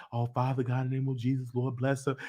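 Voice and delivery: low, soft praying voice; imitating a prayer. Family member praying over her, hushed mock prayer. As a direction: murmur a blessing over her.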